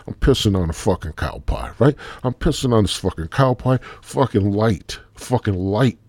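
ordinary talk, nothing marked

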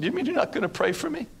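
high, soft, quick pleading; imitated tearful plea. Twisted woman begging the instructor, imitated tearful plea. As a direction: act out her hurt disbelief in a small high voice.

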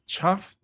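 Chuffed? asked high with a rise; puzzled Chuffed?. Shown as a demonstration. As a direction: query the word Chuffed? up high. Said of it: "Why does that sound like chuffed?".

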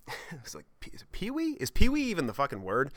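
jumping high, unsure of the word; a flustered high question; searching for a word, baffled; puzzled squeak. Real, not acted. Unsure of the word, self-mocking.

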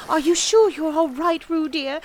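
high, loud motherly fretting; anxious calling. A narrator's reading. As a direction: call out worriedly to your child. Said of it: "called Kanga anxiously".

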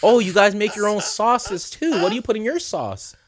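high-pitched imitation of a chatty customer; old woman customer. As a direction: put on a high old lady voice.

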